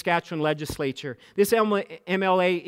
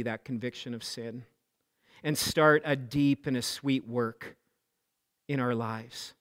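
prayer; 5 semitones lower, 4 dB quieter than usual. Acted. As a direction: pray aloud for the whole room, gently. Leads prayer.

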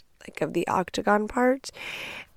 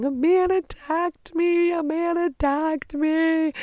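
high, slow mock wail; retold crying; 10 semitones higher, slower than usual. Woman phoning her husband after the attack, acted crying. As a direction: wail it out like a tearful call.

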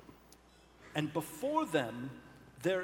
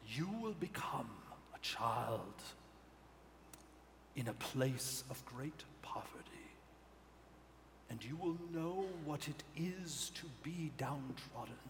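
hoarse whispering Death, lower and soft; raspy Death voice; 3 semitones lower, 7 dB quieter than usual. Acted. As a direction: whisper the sentence like Death itself. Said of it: "Death, with this raspy voice".

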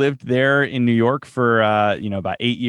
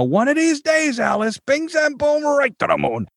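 musical bluster, pitched way up then swooping down; Ralph Kramden; 14 semitones higher than usual. Acted. Actor showing the role's inspiration, musical blustering impression. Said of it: "Ralph Kramden is he sort of had a musicality".

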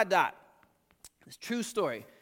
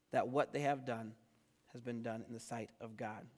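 low, measured Bible reading; scripture reading; 6 semitones lower, 7 dB quieter than usual. Acted. Pastor reads the verdict to the church, measured scripture reading. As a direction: read it solemnly, settling softer at phrase ends.

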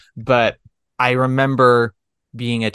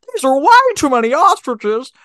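much higher, louder nervous whine; Don Knotts voice; 4 dB louder than usual. Acted. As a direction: squeak it out like a jittery Don Knotts. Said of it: "so I have to start with my Don Knotts".

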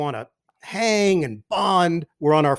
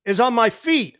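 higher, louder annoyed repeat; irritated repeat; 6 semitones higher, 3 dB louder than usual. Retold or imitated. Teammate repeating his complaint to the new broadcaster, annoyed. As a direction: spell it out a second time, fed up. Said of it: "He goes".

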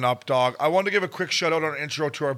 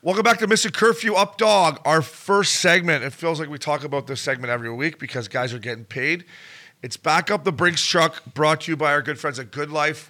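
loud, higher-pitched segment opener; podcast host intro; 3 semitones higher, 6 dB louder, slower than usual. Real, not acted. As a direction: kick off the segment with punchy host energy.

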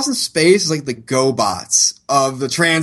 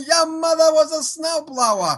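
laughing; 8 semitones higher than usual. Acted. Jokey robot insult quote.

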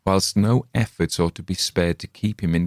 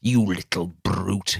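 slow bleat, teeth ground; bleating through teeth. Acted. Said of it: "he bleated, grinding his teeth".

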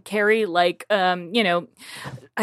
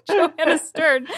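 laughing; 6 semitones higher, 3 dB louder than usual. Real, not acted. Laughing while naming friend.

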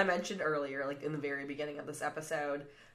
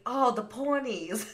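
put-on Australian accent; 7 semitones higher, 4 dB louder, slower than usual. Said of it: "in an Australian accent".

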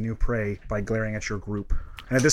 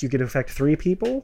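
ordinary delivery

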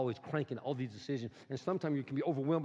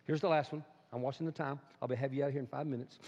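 ordinary delivery

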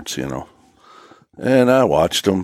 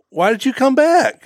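much higher, lightly voiced wife; imitating wife. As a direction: squeak out the wife's puzzled question. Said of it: "my wife says".

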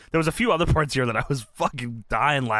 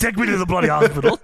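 put-on laughing British accent demand; mock British wrestler, half laughing; imitating Regal in a British accent; 5 semitones higher, 4 dB louder than usual. Voicing Regal with broken nose.

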